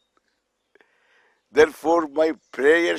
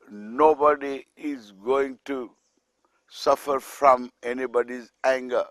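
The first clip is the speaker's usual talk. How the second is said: soft whisper; 5 semitones lower, 5 dB quieter than usual. Shown as a demonstration. Rule told to the family.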